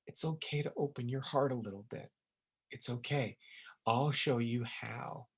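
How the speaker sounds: put-on soft, tender comfort to a hurt child; quiet and soothing, like speaking to a child; gentle reassurance. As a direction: coax the child to open up, softly. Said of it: "here to tell our inner child".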